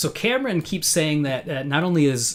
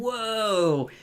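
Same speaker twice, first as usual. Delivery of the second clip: higher, drawn-out wonder; mock awe; 8 semitones higher than usual. Host as Luca looking at all the books, mock awe. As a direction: imitate someone amazed by what they see.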